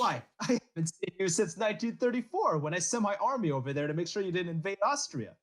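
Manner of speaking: put-on hammy Italian accent as Hitler; lightly put-on Italian accent. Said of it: "such a strong Italian accent".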